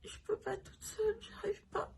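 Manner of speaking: high, broken, barely-voiced whisper through tears; faint tearful plea to the nurse, too weak to move; weak crying whisper. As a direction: plead weakly, almost no voice, in tears. Quoting her faint plea to nurse.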